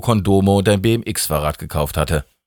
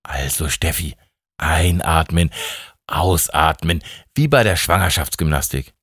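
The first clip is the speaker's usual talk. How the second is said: low, measured breathing instructions; calming coach; 5 semitones lower than usual. Acted. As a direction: talk her down like a birth-class coach. God coaches Steffi to breathe. (beruhigend, tief und mit Atempausen)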